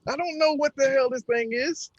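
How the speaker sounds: high-pitched protesting answer; defensive retort. Acted. Player retorts to accusing Abby.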